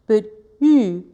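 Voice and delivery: "U" with tongue on e and lips tightly rounded, "surpris" slow; French u vowel. Shown as a demonstration. Teacher demonstrating the French u vowel. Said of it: "I can get that U".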